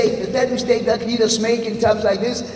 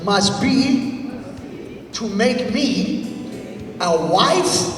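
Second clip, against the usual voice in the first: slow, drawn-out phrases to echo; slow call and response; 3 semitones lower, much slower than usual. Shown as a demonstration. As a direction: lead the crowd slowly. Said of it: "repeat after me".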